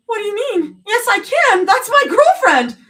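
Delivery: high, loud desperate plea; frantic protest. A narrator's reading. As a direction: argue through panic. Refusing to be held back.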